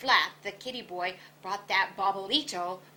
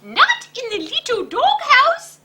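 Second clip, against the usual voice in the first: high, loud outburst of alarm; shocked exclaim; 13 dB louder than usual. A narrator's reading. Scapito hearing the bad news, loud shocked cry. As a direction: gasp it out in disbelief. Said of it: "exclaimed Scapito".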